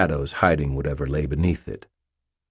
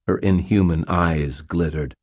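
usual speaking voice

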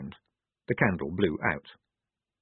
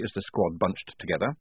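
ordinary delivery

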